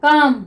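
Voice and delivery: teacher answering her own question; model pronunciation. Shown as a demonstration. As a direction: model काम clearly for students. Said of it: "हम इसको क्या पढ़ते हैं?".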